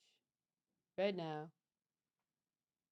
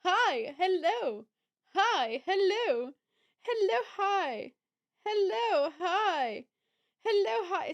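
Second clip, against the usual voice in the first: excited greetings; 7 semitones higher, 4 dB louder than usual. Real, not acted. Greeting chat viewers.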